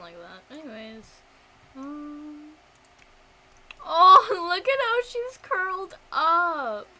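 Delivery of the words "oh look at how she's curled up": loud, high, laughing gush; big melting outburst of adoration; adoring squeal. Real, not acted. Gamer seeing the little one curled up, adoring squeal.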